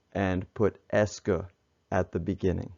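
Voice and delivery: normal talking voice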